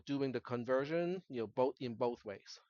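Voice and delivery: ordinary delivery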